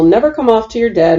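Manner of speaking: ordinary delivery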